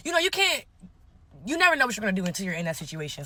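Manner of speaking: ordinary delivery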